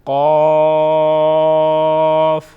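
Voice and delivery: قاف held very long and loud; drawn-out letter name. Shown as a demonstration. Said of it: "کس طرح proper پڑھیں گے". (قاف کو لمبا اور گہرا کھینچیں)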